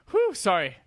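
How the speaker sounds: winded, puffing after a long rant; breathless exhale, catching air; out of breath. Real, not acted. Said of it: "I'm gonna take a breath for a second".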